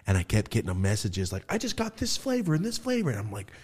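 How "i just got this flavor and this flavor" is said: high mock-excited voice; imitated excited customer. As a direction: pipe up like a thrilled buyer. Voicing customers' excited messages.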